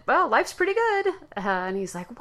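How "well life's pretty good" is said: breezy, much higher reply; a high, carefree shrug of a line; glib. Retold or imitated. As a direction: toss it off lightly and glibly. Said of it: "I glibly said".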